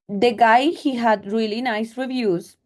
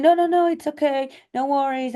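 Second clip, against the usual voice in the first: higher-pitched placating voice; imitated backpedalling; 6 semitones higher than usual. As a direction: mimic someone hastily smoothing things over. Imitates date backpedalling after offence.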